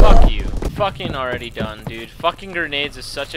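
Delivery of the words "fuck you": sudden loud angry burst; angry curse. Real, not acted. Gamer curses after dying.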